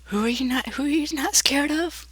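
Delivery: high, softly airy character voice; eerie high-pitched questioning; Puppet. Acted. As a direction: float it up high in a near whisper. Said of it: "Puppet says".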